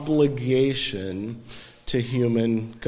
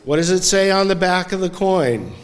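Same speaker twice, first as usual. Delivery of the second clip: 1 loud quiz; 7 semitones higher, 7 dB louder than usual. Acted. Quizzing the congregation.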